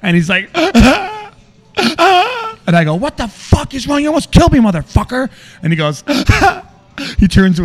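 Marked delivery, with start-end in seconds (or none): 0.5-2.6 high, loud exaggerated wail; hammed-up bawling of a crying man; mock sobbing. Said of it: "tears are just gushing". 3.0-5.3 high, loud re-enacted road rage; furious. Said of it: "And I'm so angry".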